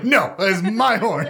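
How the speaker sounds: high, laughing mock protest; playfully possessive objection through laughter; Popo. Joking refusal to give up the horn, mock-indignant. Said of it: "Popo's like".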